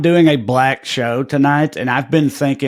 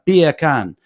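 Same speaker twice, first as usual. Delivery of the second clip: beer can in a British accent, r dropped; clipped-r British "beah cahn". Shown as a demonstration. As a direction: say it British, like "bacon" in Jamaican. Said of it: "in a British accent".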